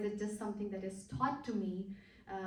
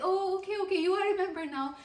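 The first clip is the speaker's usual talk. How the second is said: higher, louder, quick burst of sudden remembering; surprised recognition; 11 semitones higher, 10 dB louder, faster than usual. Retold or imitated. Retelling how the friend finally recognized her, surprised and quick. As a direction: play the friend realizing who wrote, all at once.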